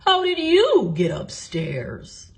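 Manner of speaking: friendly watchman greeting; man's voice, lightly done. A narrator's reading. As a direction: find a bear under the cover. Watchman questions bear.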